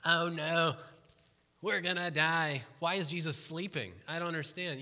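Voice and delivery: panicked disciples. Retold or imitated. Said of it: "They're like".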